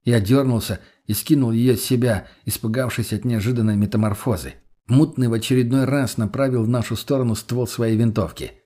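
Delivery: plain reading voice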